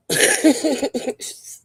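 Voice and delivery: breathy burst of laughter; laughing. Real, not acted. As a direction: laugh it off after ranting. Laughs at her own rant.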